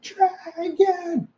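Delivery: hammed-up peasants shouting up the hill; mock villagers calling. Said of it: "and you're like".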